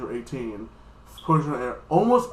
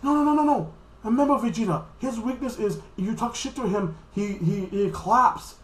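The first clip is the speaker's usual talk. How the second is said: animated anime coach imitation; Beerus; 9 semitones higher than usual. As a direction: call out urgently in a high voice. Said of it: "Beerus like this".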